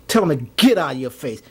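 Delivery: ordinary delivery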